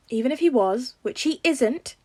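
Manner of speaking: insistent. Acted. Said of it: "Peter insisted".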